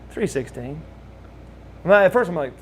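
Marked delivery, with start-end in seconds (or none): 0.1-0.8 soft, faint retold voice; weak patient. Paralyzed Lex telling his room number, weakly. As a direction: lightly imitate a patient on a respirator, hushed. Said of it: "like, breathing on a respirator".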